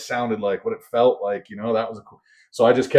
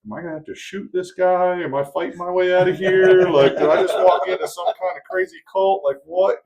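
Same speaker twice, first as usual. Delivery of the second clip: alarmed inner thoughts; 8 semitones higher, 4 dB louder than usual. Retold or imitated. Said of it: "I was like".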